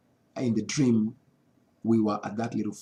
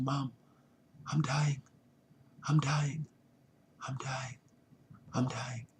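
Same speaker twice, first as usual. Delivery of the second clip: fading plea; 2 semitones higher, 6 dB quieter than usual. Retold or imitated. Reaching out to his mom while dying in the dream, fading plea. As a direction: let the life drain out of it as you repeat.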